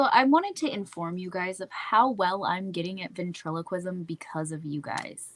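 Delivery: usual speaking voice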